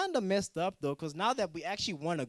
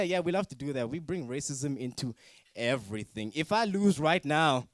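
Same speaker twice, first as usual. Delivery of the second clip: normal talking voice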